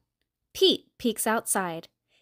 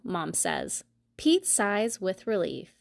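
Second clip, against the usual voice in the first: plain reading voice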